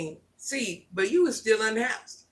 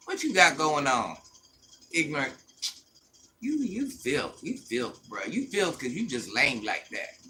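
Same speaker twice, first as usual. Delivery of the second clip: real contempt; 8 semitones lower, 5 dB quieter than usual. Real, not acted. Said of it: "I ain't even soft like".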